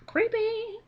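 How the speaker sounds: Creepy said high and drawn out; emphatic creepy. Real, not acted. Telling viewers the movie is creepy, high stretched emphasis. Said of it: "It is creepy".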